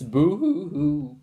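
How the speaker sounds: slow, hammed-up boo-hoo wail; mock happy sobbing. A narrator's reading. Mother bursts into happy tears, slow hammed-up wail. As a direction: sob it in a changed voice. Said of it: "Missus Lovey-Dovey to turn to burst into tears".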